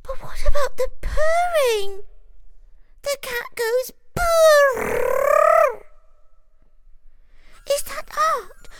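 very high, loud squealing; anxious high shout. A narrator's reading. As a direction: argue in a panic. Frightened mouse argues.

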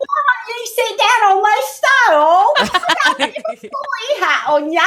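high, slow, laughing British prefect voice; hammed-up British accent through giggles; a put-on British witch welcoming kids, laughing. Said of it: "in my little British accent".

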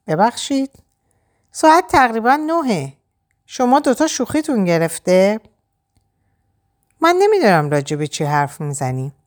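lightly voiced Liv, bewildered; confused Liv. A narrator's reading. (لیو گیج و متعجب، کمی زیرتر)

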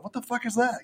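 high-pitched baffled beast reaction; mock bewilderment. Acts out puzzled beast looking up.

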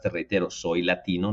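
normal talking voice

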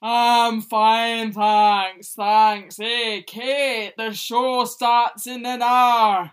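the cow declining drinks, loud and Scottish. Acted. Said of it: "when I do Damien's voice".

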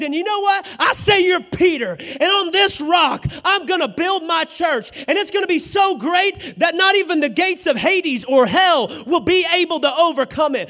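loud, high shouted proclamation; Jesus proclaiming. Acted. Jesus proclaiming to Peter, shouting with joy. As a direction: declare it like a triumphant promise.

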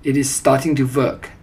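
usual speaking voice

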